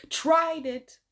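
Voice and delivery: high, punched-up repeat; mock indignation. Repeating why the joker got it, high mock indignation.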